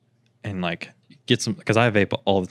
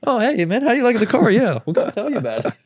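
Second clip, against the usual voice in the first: much higher, louder, fast friendly pitch; fake salesman voice; 13 semitones higher, 4 dB louder, faster than usual. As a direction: turn on the eager salesman charm. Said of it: "voice change, like, his inflection".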